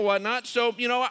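ordinary talk

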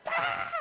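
high imitated panicked scream; short squealing child's scream; panicked kid scream. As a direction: mimic a terrified little kid's shriek. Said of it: "deep, panicked three-year-old girl scream".

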